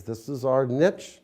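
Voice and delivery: ordinary talk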